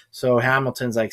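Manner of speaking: normal talking voice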